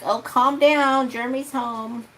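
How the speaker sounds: mocking auntie's brush-off; mocking mimicry. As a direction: parody the dismissive relative. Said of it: "And now you're like".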